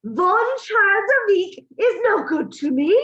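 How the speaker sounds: high, loud, slow scornful screech; the Grand High Witch berating her audience, yelling in fury; furious witch yelling. A narrator's reading. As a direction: scold the witches like vermin. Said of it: "cried out".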